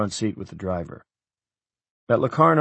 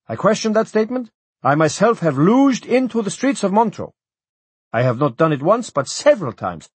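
high, louder, squabbling bureaucrat; quarrelling official; 11 semitones higher, 5 dB louder than usual. A narrator's reading. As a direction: argue the point, pitched high and loud.

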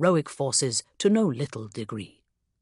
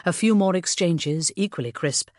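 usual speaking voice

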